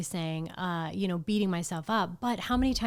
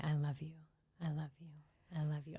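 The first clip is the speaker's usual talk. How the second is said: soft whispered I love you; 5 semitones lower, 15 dB quieter, faster than usual. Retold or imitated. Touching someone and saying it.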